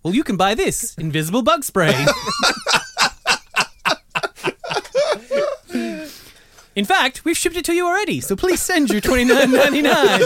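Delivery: hyped-up, high, loud ad voice; infomercial. Pretend. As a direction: sell it like a shouty TV ad. Mock bug spray ad, loud high.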